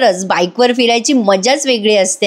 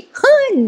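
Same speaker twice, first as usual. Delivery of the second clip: high burst of laughter. Real, not acted. Narrator laughs at the plan.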